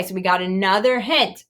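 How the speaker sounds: ordinary delivery, nothing marked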